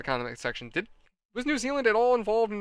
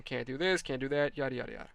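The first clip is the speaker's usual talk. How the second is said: mock complaining; 3 semitones lower, 7 dB quieter than usual. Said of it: "starts crying and complaining".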